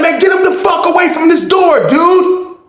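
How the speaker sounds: high, shouted get-away; angry yell. Retold or imitated. Mike at his door chasing the dude off, high angry yell. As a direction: bark him off the door, high and loud. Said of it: "He was like".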